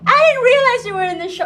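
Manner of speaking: high, loud burst of mock surprise; surprised. Telling a co-host how she found him in the show, surprised. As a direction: retell your surprise, pitched right up.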